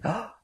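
mock shocked gasp; high, sharp intake of breath. Shown as a demonstration. Someone on stage hearing of the deaths, gasping. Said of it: "to gasp, to say".

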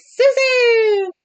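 long, high, loud call; hammed-up high holler; acted yell. A narrator's reading. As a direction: yell the name across the house. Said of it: "Sam yells".

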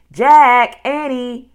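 high, loud calling of names; distant cry. A narrator's reading. Mother calls her children home, distant cry. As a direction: call out as if from far off. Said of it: "came the distant cry again".

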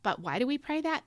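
ordinary delivery

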